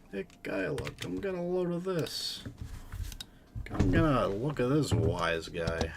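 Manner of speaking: hammed-up New York wise-guy accent. Streamer calling Kyla over, amateur New York accent. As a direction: put on a bad New York twang. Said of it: "I'm not good at accents".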